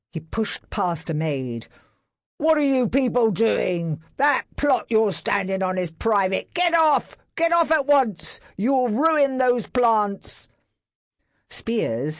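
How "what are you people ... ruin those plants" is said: loud, higher barked orders; angry orders. A narrator's reading. Barrett ordering servants off his plot, angry. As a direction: order them off at once.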